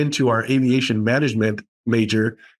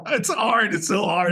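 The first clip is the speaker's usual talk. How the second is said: laughing at own slip; 8 semitones higher than usual. Real, not acted. Laughs at own slip over word.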